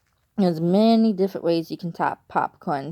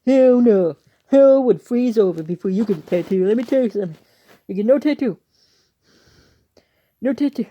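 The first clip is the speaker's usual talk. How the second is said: loud, raised-pitch imitation of a strict dad; scolding dad; 3 semitones higher, 7 dB louder than usual. Dad forbidding a tattoo to the child, mock scolding. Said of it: "My dad's like".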